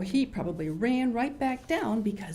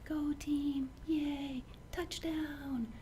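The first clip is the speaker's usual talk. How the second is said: softer, higher, timid cheer; tiny quiet cheer; 7 semitones higher, 8 dB quieter, much slower than usual. Shown as a demonstration. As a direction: cheer as if afraid to be heard. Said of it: "are you gonna sit there and go".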